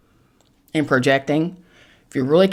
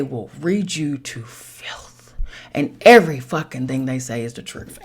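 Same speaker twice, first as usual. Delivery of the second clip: whisper; 3 semitones lower than usual. Real, not acted. Confiding warning about Pisces.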